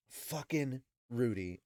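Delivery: slow, drawn-out head-shaking complaint; slow exasperated repeat. Real, not acted. Host exasperated with Rudy, slow repeat. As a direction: say it aloud, shaking your head.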